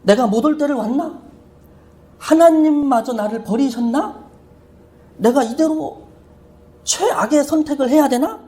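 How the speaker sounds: high-pitched imitation of a tearful lament; mock tearful. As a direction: voice his despair, lightly acted. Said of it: "막 울면서 그 얘기를 하더라고요".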